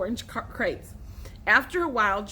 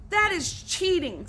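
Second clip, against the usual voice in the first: accusing; 7 semitones higher than usual. A narrator's reading. Billy accuses the cheaters.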